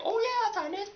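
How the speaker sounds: very high, breezy mock voice; imitated advisor. Advisor agreeing to sign a slip, imitated high voice. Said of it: "and she's like".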